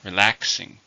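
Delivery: "Relaxing" with a strong LAX; stress on second syllable. Shown as a demonstration. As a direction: punch the middle syllable of "Relaxing". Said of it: "stress is on the second".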